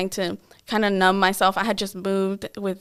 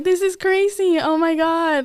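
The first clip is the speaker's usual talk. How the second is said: much higher, incredulous aside; stunned disbelief; 8 semitones higher than usual. Retold or imitated. Narrator to herself after betrayal, high and stunned. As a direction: gasp it out in shock.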